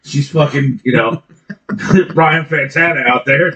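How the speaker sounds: ordinary delivery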